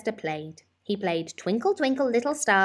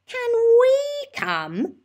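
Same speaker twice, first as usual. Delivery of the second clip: put-on very high, slow childlike voice; pleading child; 7 dB louder, much slower than usual. A narrator's reading. Lester asking his mother to come along, high and slow. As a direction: stretch it out like a small boy begging.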